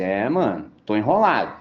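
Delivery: low, resigned "tô enrolado"; mock worried guy. A churchgoer voicing someone short on resources, resigned. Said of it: "fala assim".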